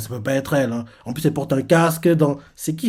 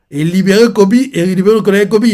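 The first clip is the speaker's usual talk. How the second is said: louder, higher hype; excited; 6 semitones higher, 9 dB louder than usual. Real, not acted. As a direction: celebrate the rescue. Hyped reading reaction. (enthousiasme fort)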